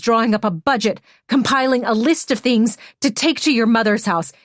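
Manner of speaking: furious accusation. Acted. Hannah's furious tirade at Emmy.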